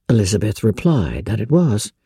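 usual speaking voice

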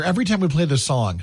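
ordinary talk, nothing marked

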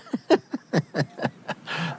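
soft, breathy chuckle; quiet laugh trailing on after a joke. Real, not acted. Host cracks up at joke.

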